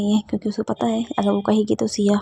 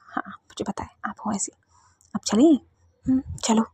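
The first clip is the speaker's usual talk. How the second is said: breathy unvoiced whisper; 6 dB quieter than usual. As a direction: whisper it close, like a secret. Girls whisper while walking in.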